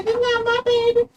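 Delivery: very high squeaky pretend baby voice; voicing the baby. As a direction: speak for the baby in a tiny high voice.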